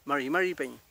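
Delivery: MARI MARI PEÑI with an English-like Mapuche r; correct Mapuche form. Shown as a demonstration. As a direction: show MARI MARI PEÑI without trill or tap. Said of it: "Esa sería la forma".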